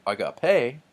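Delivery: "agape" slowly with PE stressed, a-ga-PE; wrong last-syllable stress. Shown as a demonstration. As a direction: lean on the final syllable of "agape". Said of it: "tells me that this isn't".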